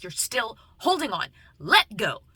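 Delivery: intense, punched-up villain quote; Kylo Ren. Retold or imitated. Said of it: "and he's like".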